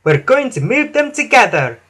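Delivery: proud, high voice; Emily. A narrator's reading. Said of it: "chuffed Emily proudly".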